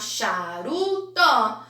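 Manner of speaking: "charutó" slowly split as cha, ru, tó; syllable by syllable. Shown as a demonstration. Teacher assembling a word for pupils, "charutó" slowly syllable by syllable. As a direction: sound out "charutó" slowly, final vowel opened to ó. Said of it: "Vou completar com Uru e com Tó".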